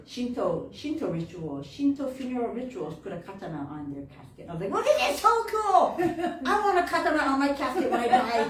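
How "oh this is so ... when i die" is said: high, loud, fast gushing with laughter; excited. Retold or imitated. Granddaughter learning of the katana on the casket, excited. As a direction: blurt it out in giddy delight.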